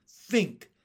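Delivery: high, loud urgent plea; begging. A narrator's reading. Miss Spider pleading with James, urgent begging. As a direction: beg with one punched word. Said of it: "begged Miss Spider".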